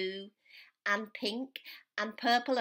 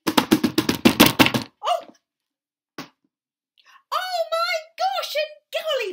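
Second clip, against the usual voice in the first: high breathy startled gasp, much higher and louder; startled cry; 13 dB louder, much slower than usual. A narrator's reading. The startled elephants reacting to Elmer's boo, high and breathy. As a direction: gasp in fright. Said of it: "they said".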